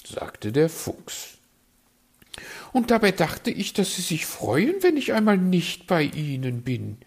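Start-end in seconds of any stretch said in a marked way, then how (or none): 2.7-7.0 high, preening bird voice; a vain magpie fishing for compliments. Acted. Magpie teases.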